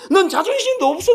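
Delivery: the mother's question, high and pushed; scolding again. Retold or imitated. Mother repeats her scolding question, high and pushed. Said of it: "막 야단을 치니까".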